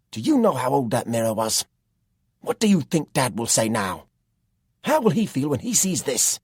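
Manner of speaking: loud, high furious questions; angry shouting. A narrator's reading. Jack shouts at Tom over the heirloom mirror, furious. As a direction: tear into him over the broken mirror. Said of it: "No wonder Jack was angry".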